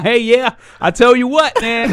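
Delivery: high, folksy fake small-talk voice; fake neighbor talk. Friend chatting with his neighbor, high folksy fake voice. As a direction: do the phony friendly neighbor chat. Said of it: "[name] talking about".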